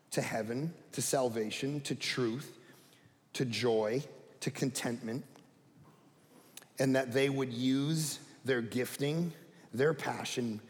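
soft, low prayerful voice. Acted. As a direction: pray quietly and earnestly. Pastor prays.

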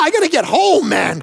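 high-pitched mock panic; retold freak-out. Preacher retelling his alarm to the pastor, freaking out. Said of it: "freaking out".

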